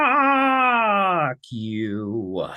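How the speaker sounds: long, stretched curse sliding down; drawn-out exasperation. Real, not acted. Viewer reacting to a slippery debater's question, drawn-out exasperation. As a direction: let the curse drag out in frustration. Exasperated outburst at the played clip.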